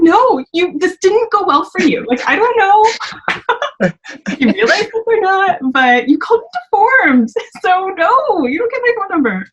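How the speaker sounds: high-pitched retort broken by laughs; amused refusal. Retold or imitated. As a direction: turn him down, laughing at him. Retelling refusing his number.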